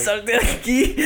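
mock urgent. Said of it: "le hago señas a mi mamá".

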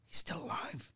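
whispered, imitating the woman; barely voiced, awkward retold whisper; hushed confession. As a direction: say it under your breath.

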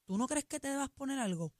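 low, soft, careful "Mi amor"; gentle correction. Shown as a demonstration. Host showing how to tell a partner about see-through pants, soft. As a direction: suggest a change very gently. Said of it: "mira la manera correcta".

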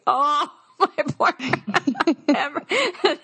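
high-pitched, laughing through the words; retold dismay breaking up into laughter; laughing mock sympathy. Telling the host's reaction to a friend's text, laughing.

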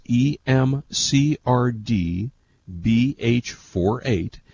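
each letter spelled out slowly; slow, deliberate repeat. Shown as a demonstration. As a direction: read the code out carefully. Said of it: "let me repeat that again".

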